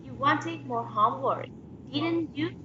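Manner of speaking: "didn't you" goes high at the end; unsure, rising "didn't you"; rising tag question. Shown as a demonstration. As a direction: exaggerate the lift on "you". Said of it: "it's going to be like exaggerating".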